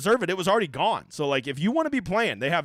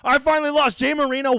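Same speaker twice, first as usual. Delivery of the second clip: higher and louder mock outrage; hammed-up loud lament; 8 semitones higher, 6 dB louder than usual. Host theatrically admitting his streak ended, loud and high. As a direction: belt it like a big drama. Mock-dramatic loss reaction.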